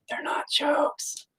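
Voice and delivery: slow whispered confession; hushed aside. Pretend. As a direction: whisper it slowly, as a secret aside. Hushed aside after joking.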